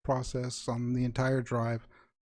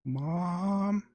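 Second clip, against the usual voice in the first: put-on much higher, a child calling through crackle; a small voice breaking up on a bad line; distorted faraway call; 10 semitones higher than usual. Son calling his mother, faint and distorted.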